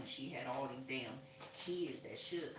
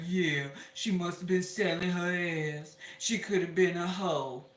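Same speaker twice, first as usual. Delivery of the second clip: mocking gossips; 3 semitones higher, 11 dB louder than usual. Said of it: "Y'all wanna get in and be like".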